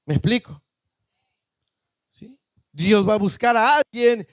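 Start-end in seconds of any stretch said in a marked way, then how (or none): none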